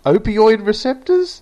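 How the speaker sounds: high, loud, slow astonished question; mock amazement. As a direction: gasp it out in mock awe.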